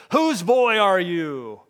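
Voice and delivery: slow booming call across the crowd; slow, loud preacher's bellow. Acted. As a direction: boom it out so the whole lobby hears. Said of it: "the preacher bellowed in a voice loud enough for everybody to hear".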